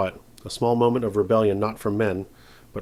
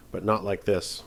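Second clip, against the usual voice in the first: usual speaking voice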